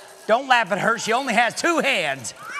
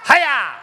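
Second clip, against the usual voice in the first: karate yell; 4 semitones higher, 6 dB louder than usual. Acted. Ninja karate shout.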